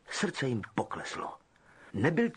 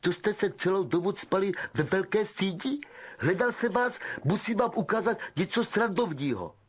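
put-on higher sneering boy's voice; a spoilt sneering rich kid; Malfoy taunting his cronies; Malfoy's sneer; 6 semitones higher than usual.

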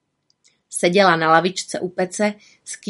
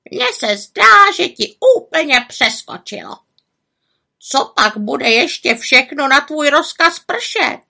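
high, loud raven tirade; croaking raven; 9 semitones higher, 6 dB louder than usual. A narrator's reading. Raven ridiculing the witch's spell, scolding croak. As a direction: squawk with mocking indignation. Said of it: "krákal havran".

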